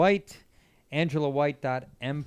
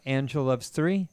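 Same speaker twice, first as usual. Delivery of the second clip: ordinary delivery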